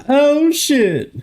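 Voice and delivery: sudden high-pitched yelp of dismay; a real shocked squeak at the broken green screen; startled squeal. Real, not acted. Streamer yelping when the green screen fails.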